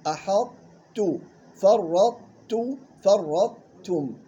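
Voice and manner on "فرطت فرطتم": فرطتم with the تاء fully pronounced, slow; clear final T. Shown as a demonstration. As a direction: give the تاء its full due in فرطتم. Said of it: "إخراج التاء وأعطاها حقها".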